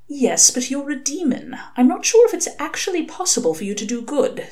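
an angel patiently explaining, a touch prim; earnest angel voice. Acted. Angel Aziraphale gently lecturing the demon, earnest.

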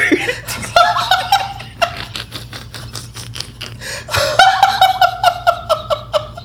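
silent wheeze laugh; breathless wheezing laugh. Real, not acted. After punchline.

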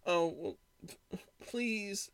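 mimicking a pleading, half-crying customer, breaking up high and soft; a teary, faltering beg imitated; near tears. Clerk mimics the customer begging at closing, on the verge of tears. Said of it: "She's almost crying".

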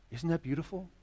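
ordinary talk, nothing marked

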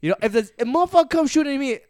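very high, loud rapper impression. Retelling a rapper's gun video, high and loud. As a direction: rush the boast in a high, raspy voice. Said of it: "[name] making gun sounds".

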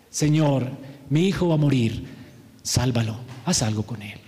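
a broken father begging, voice sinking to almost nothing; official's humbled plea. Retold or imitated. Preacher voicing the humbled official's plea, low and fading. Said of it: "¿Ven el cambio de fe?".

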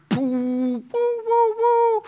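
high, loud rocket-like woos; celebratory whooping. Acted. Fan who wins the championship, high loud whooping. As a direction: let off long celebratory whoops. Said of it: "And you're just like".

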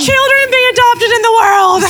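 high, loud, slow cheery announcement; mock cheer. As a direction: beam it out like a parade. Joins the mock celebration.

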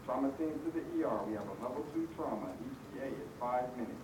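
distant overhead PA announcement; paged over the hospital intercom; hospital page. Real, not acted.

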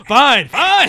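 high laughing give-in. Real, not acted. Laughing concession.

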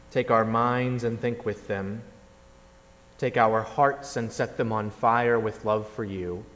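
measured congregational prayer. Acted. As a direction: pray it humbly to God. Opening prayer before sermon.

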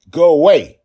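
furious loud command; a desperate father's loud demand; angry shout. A narrator's reading. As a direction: bellow at the giant to leave. Father orders giant away.

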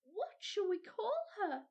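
put-on high, eager child chattering; a small girl admiring the biggest kernel; child naming what she sees, thrilled; little girl's voice. A narrator's reading. Mildred asks eagerly.

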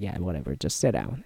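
low, soft, indifferent mumble; bored flight attendant. Retold or imitated. Flight attendant waving the tourist into any seat, bored. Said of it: "He's like".

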